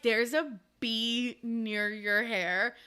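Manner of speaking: slow, careful words; slow, deadpan warning. Retold or imitated. Antony warning her slowly about the bee. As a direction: say it slowly, like not to startle her. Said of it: "and he's like".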